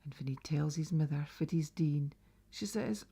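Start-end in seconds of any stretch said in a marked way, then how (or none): none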